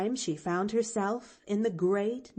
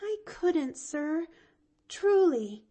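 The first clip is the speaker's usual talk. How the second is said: put-on high, faltering little girl; timid child faltering; 10 semitones higher, slower than usual. A narrator's reading. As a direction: let the words waver. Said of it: "faltered Pollyanna".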